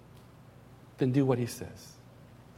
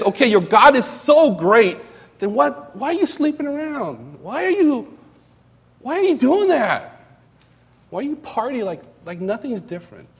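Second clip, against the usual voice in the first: higher mocking challenge, pitch climbing on each why; unbelievers rebuking; 6 semitones higher than usual. Preacher voicing unbelievers who rebuke hypocritical believers, higher mocking scorn. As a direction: scold the believer like a scoffing outsider. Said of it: "unbelievers will look at us and we says".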